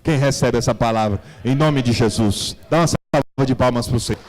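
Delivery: preacher's call. Acted. Preacher calling the church to applaud the Lord, loud. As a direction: rouse the congregation to applaud.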